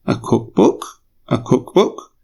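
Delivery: "cookbook" rising at the end like a question; question intonation. Shown as a demonstration. Host surprised at what Harry said, question intonation. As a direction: repeat "cookbook" with a questioning upturn. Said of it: "He said it using a question intonation".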